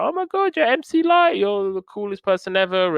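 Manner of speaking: very high, thrilled fan squeal; starstruck girl. Retold or imitated. The girl meeting [name], high excited squeal. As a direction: squeal like a kid meeting her idol. She's like.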